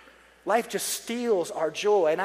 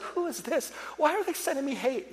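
higher, softened mock indignation; mock dismay; 6 semitones higher, 5 dB quieter than usual. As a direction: act out baffled dismay at an email. Acted reaction to hateful email.

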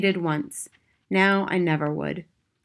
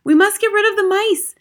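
high-pitched, loud alarm; panicked yelling; 13 semitones higher, 6 dB louder than usual. A narrator's reading. Whole dining room yelling about the mice, high panicked yell. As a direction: yell the warning high and urgent. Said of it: "everyone was yelling".

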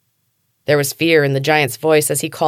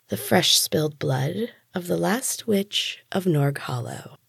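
purring; 7 dB quieter, slower than usual. A narrator's reading. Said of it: "she purred".

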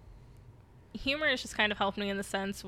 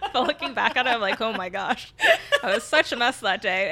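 giggling through the words, louder; laughing; 2 semitones higher, 7 dB louder than usual. Real, not acted. To her co-host about the dog story, laughing. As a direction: laugh while you admit it.

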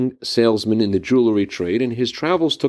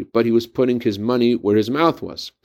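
ordinary talk, nothing marked

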